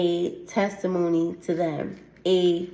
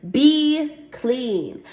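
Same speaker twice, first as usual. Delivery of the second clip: commanding Jesus; 5 semitones higher than usual. Acted. Jesus commands the leper to be clean, firm and raised. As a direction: speak it as a healing command.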